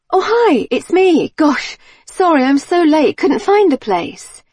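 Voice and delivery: high, loud, over-friendly chatter; gushing latecomer putting on innocence; feigned breezy apology. A narrator's reading. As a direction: call brightly into an intercom, pitched right up. Feigning late arrival at intercom.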